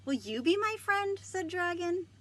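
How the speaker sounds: high, loud, pleading Dragon voice; hopeful dragon. Acted. Dragon asking the squirrel for friendship, high and hopeful. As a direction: make him sound eager for a friend.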